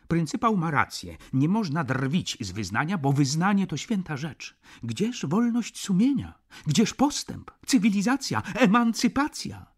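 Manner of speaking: fly into a righteous huff; heated exclamation. Acted. Clerk Zięba sides with the boss, heated and high. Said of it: "zaperzył się i zawołał".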